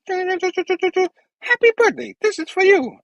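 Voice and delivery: tiny squeaky gadget voice, very high; little bug thing. Acted. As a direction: chirp it in a high, thin voice. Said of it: "the little bug thing goes".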